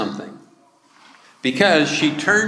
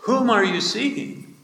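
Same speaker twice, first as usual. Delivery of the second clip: gently questioning, pitched higher; voicing Jesus; 9 semitones higher than usual. Jesus gently asks weeping Mary why she cries, higher voice.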